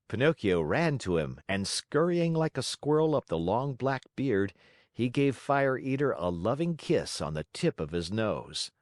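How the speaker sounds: ordinary delivery, nothing marked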